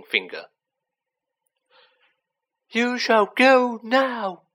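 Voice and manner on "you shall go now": high, loud, very slow elf command; fierce high order, each word stretched. A narrator's reading. Dobby pointing down at Malfoy, fiercely. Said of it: "he said fiercely".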